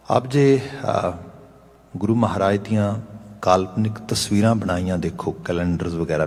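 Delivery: ordinary delivery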